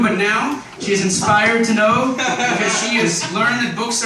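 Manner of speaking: loud, high proclamation with laughter; mock announcement. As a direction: keep announcing it grandly, laughing.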